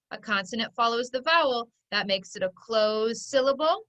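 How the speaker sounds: normal talking voice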